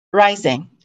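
normal talking voice

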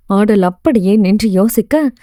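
ordinary delivery, nothing marked

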